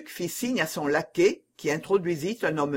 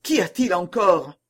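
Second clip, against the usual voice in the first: ordinary delivery, nothing marked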